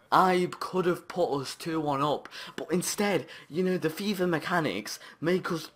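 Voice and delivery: high, loud swearing outburst; real angry high-pitched tirade; furious rant. Real, not acted. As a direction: rage at the mechanics. Raging at game mechanics.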